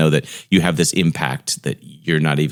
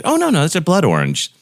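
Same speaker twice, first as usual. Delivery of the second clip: bright reassuring answer; 10 semitones higher than usual. Retold or imitated. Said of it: "I said".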